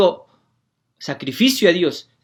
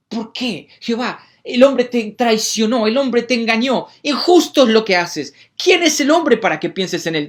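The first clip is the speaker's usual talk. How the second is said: passionate preaching; 8 semitones higher, 5 dB louder than usual. Acted. Preacher crying out to God in David's voice, passionate and loud.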